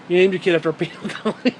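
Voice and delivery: breaking into laughter mid-sentence; amused, laughter bursting through the end; laughing. Real, not acted. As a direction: crack up as you say it. Cracking up at his own joke.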